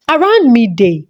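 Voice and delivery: ordinary delivery, nothing marked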